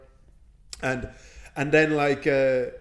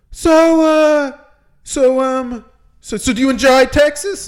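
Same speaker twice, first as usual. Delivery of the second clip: high, loud put-on voice of a flirty mayor; mock flirty mayor, pitched up; mayor's voice; 9 semitones higher, 6 dB louder, slower than usual. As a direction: ham up the smarmy small-town mayor.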